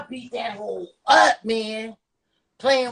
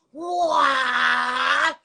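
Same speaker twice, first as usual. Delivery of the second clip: mock battle cry; 6 semitones higher than usual. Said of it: "'cause I was like".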